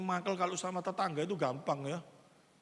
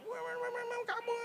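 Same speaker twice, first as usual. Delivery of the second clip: high shrill-free squeaky nagging, much higher; mock scolding. Scolding a neighbour from the mouth, high mock nag. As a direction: nag someone in a high put-on voice. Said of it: "masih ngomel".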